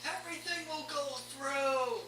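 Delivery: high villain vow. Acted. GM voicing the fighter mid-swing, high-pitched vow. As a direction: swear it like a fanatic. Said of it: "he's all like".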